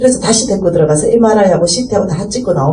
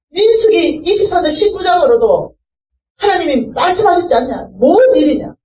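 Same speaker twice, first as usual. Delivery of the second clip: crying out to God; 8 semitones higher than usual. Retold or imitated. Testifier crying out to God all night in the hospital, a raised pleading demand. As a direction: hurl the questions at God, half-shouting. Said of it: "하나님 앞에 절규했어".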